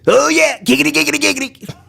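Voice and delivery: high, rapid lecherous catchphrase; Quagmire. Acted. Neighbor's signature catchphrase, high lecherous chant. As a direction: chatter it like a cartoon lech. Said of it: "Quagmire is his signature".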